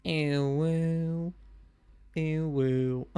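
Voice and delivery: soft playful howl. Pretend. Echoing the howl back.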